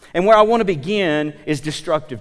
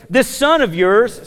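resentful brother; 6 semitones higher, 5 dB louder, slower than usual. Retold or imitated. Angry older brother voiced.

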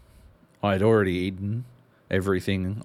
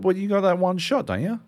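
mate's voice; 11 semitones higher, 3 dB louder than usual. Retold or imitated. Voicing mate.

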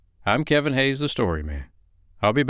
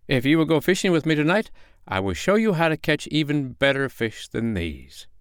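higher, quick, sly fox voice; 7 semitones higher than usual. Acted. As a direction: play the tricky fox luring the bear.

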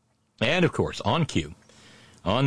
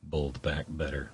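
muttered aside; 5 semitones lower, 9 dB quieter, slower than usual. Real, not acted.

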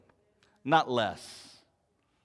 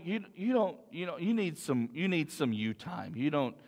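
coaxing voice; 3 semitones lower, 8 dB quieter than usual. Acted. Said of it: "they may shout at you".

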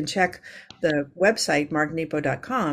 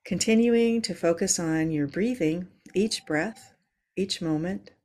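calm grounding exercise. Acted. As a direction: ease them into their breath. Leads a yoga breathing moment.

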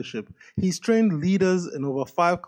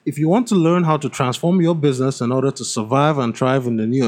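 upbeat, slightly louder ad-read voice; sponsor ad read; 5 dB louder than usual. Acted. Host reading a sponsor message to listeners, upbeat ad read. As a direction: sell the summit like a radio spot.

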